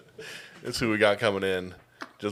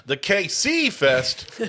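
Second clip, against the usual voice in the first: high, drawn-out event announcer; hyped announcement; 9 semitones higher, 3 dB louder, much slower than usual. Acted. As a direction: stretch out the name like a big reveal. Grandly announces his own festival.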